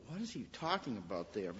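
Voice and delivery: preacher musing quietly over the verse; hushed puzzled aside. Retold or imitated. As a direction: drop to a near murmur, baffled.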